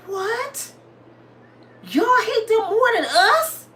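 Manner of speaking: high-pitched, drawn-out disbelief; slow, squeaky incredulous question; astonished. Retold or imitated. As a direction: stretch it out in comic shock. Acts out astonishment at boss.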